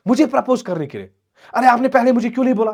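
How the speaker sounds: high, loud, astonished questioning; shocked daughter. Acted. Daughter learning of the proposal from father, shocked. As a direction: react in disbelief, pitch jumping up.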